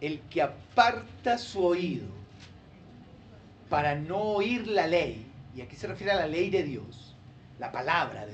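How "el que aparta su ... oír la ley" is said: slow, phrase by phrase; slow, careful scripture reading; reading slowly. Shown as a demonstration. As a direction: read the verse slowly with pauses between phrases. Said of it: "Yo le voy a ayudar a leerlo despacito".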